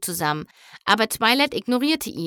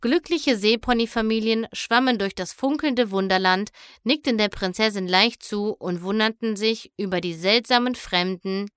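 ordinary delivery, nothing marked

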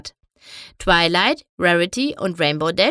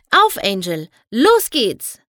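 rallying call; 6 dB louder, slower than usual. A narrator's reading. Fluttershy puffs up and urges Angel on, calling out.

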